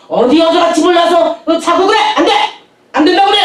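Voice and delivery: high, loud scolding refusal; a retold pastor's loud no; stern scolding. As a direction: scold her for leaving home. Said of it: "딱 그러시더라고".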